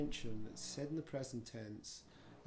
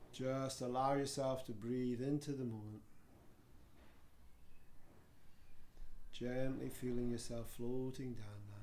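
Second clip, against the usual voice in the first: guided meditation; 2 semitones lower than usual. Acted. Yoga teacher guiding students to float down into relaxation, guided meditation.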